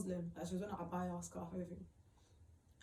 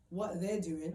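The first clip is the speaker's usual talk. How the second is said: ordinary talk, nothing marked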